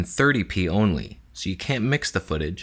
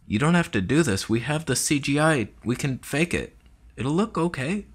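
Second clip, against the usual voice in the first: higher, pleading crew voice; pleading crew member; 7 semitones higher than usual. Pretend. Crew member pleading with DiCaprio, in a higher voice. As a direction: beg the star to use CGI.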